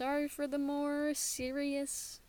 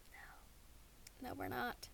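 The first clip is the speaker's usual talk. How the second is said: breathy whispered no; whispering; 11 dB quieter, much slower than usual. Real, not acted. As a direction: whisper the answer. Whispered answer.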